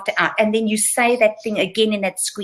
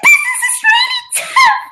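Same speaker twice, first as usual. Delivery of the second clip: very high, loud, pathetic squeak; squeaky ridiculous voice; 6 dB louder than usual. Shown as a demonstration. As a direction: squeak it out like a silly little mouse. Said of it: "the most pathetic, squeaky, mousy, or ridiculous voice".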